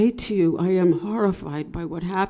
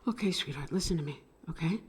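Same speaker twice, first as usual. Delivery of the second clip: soothing; 4 semitones lower, 10 dB quieter, much faster than usual. Real, not acted. Calming girlfriend on the phone.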